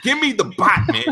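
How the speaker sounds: high, heated mock rant at the dog; exasperated rant. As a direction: chew out the useless dog. Yelling at dog in game.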